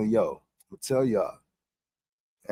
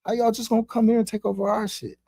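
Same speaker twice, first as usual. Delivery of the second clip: a hint of an indignant higher-pitched god; lightly voiced outraged Enlil, pitch up; mildly hammed-up angry god voice; Enlil scolding; 10 semitones higher, faster than usual. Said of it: "That's how they felt".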